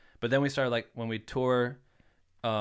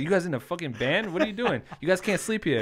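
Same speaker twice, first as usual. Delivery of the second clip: put-on New York accent; 7 semitones higher than usual. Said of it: "he's all New York accent".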